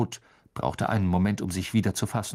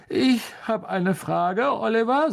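just-woken George; 10 semitones higher, 4 dB louder than usual. Acted. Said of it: "der aus dem Schlaf hochgeschreckte George".

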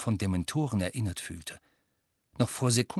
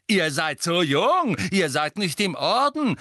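hoch, laut und langsam die Mutter nachmachen; spöttisch die Mutter imitieren; Mutter nachgeäfft; 9 semitones higher, 7 dB louder than usual. A narrator's reading. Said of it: "mit schriller Stimme". (Sohn äfft die Mutter spöttisch mit hoher Stimme nach)

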